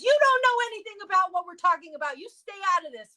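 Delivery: mock indignant yell; rabbi shouting. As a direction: snap at the walls to butt out. Rabbi yelling at walls.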